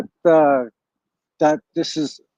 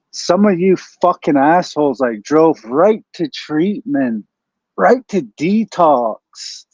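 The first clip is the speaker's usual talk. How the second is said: drawn out, leaning hard on right; slow it right down and stress right to detox; slow emphasis; slower than usual. Real, not acted. Complaining to viewers about sobered-up guys, slow and pointed.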